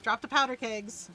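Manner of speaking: ordinary talk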